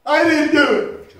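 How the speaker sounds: slow, loud whiny protest; mock kid protest. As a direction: drag out the kid's denial. Kid argues with parent.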